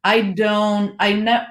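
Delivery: ordinary talk, nothing marked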